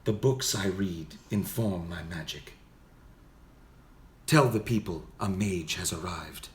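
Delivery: slow, restrained wizard voice; soft-spoken mage. Shown as a demonstration. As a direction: intone it solemnly without forcing the volume. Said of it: "a mage might be more soft-spoken".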